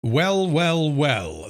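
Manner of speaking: drawn-out smug gloat, falling; smug well well well. Acted. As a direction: savour it like a villain catching someone out. Gleeful villainous well well well.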